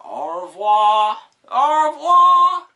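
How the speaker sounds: very high, loud, slow mock "au revoir"; loud comic French sign-off; silly French farewell. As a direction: ham up the goodbye. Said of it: "sign-off in funny voice".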